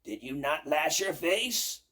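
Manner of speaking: loud accusing demand; witch yelling. Acted. Baba Yaga yelling at the birch tree. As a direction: snap the question. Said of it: "yelled the witch".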